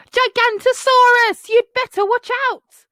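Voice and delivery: high, loud warning yell; shouting. A narrator's reading. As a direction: shout it across the hill. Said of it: "they heard a shout".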